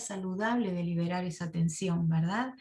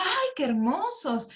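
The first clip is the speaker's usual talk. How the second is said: mock admiration; 7 semitones higher, 3 dB louder than usual. Admiring the shoes.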